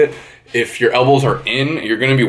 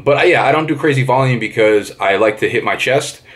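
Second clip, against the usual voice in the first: usual speaking voice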